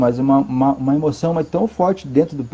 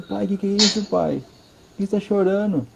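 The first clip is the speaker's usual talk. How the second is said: higher and softer, a hint of a small boy asking; little son's voice; 5 semitones higher, 5 dB quieter than usual. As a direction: lightly voice a five-year-old woken at night. Son wakes and asks father.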